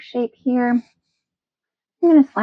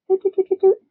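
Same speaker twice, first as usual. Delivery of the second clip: high sing-song doots, one per brush dab; playful doot sounds; 10 semitones higher than usual. Acted. Teacher stippling paint, voicing each dab with high doots. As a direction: vocalise each dab like a little tune.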